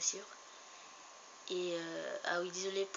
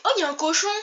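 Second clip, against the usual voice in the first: excited squeal; 8 semitones higher, 14 dB louder, faster than usual. Real, not acted. Spots a pig, squeals.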